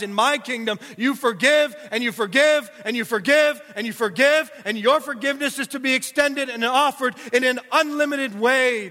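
a preacher building to a climax, loud and ringing; full-force preaching. Acted. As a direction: drive the point home to the congregation.

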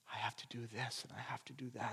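hushed inner-thought whisper; whispered worried inner voice. Retold or imitated. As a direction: murmur it like thoughts crowding in. Voicing anxious thoughts during prayer.

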